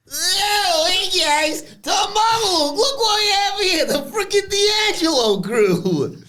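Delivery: high hammed-up Italian-American accent, slowing at the end; mock Italian wiseguy taunting the crew; new Italian accent. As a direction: play a sneering New York Italian mobster. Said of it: "do a new Italian accent".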